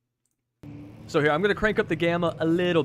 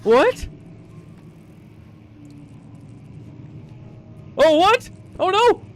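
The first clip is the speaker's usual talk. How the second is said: higher, louder startled cries; shocked; 9 semitones higher, 4 dB louder than usual. Real, not acted. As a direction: yelp in sudden alarm. Shocked by game event.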